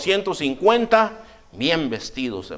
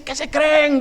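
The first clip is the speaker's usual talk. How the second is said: much higher, raised angry challenge; furious king; 11 semitones higher, slower than usual. Retold or imitated. Furious king confronting the three young men, high angry challenge. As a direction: demand an answer like an outraged king.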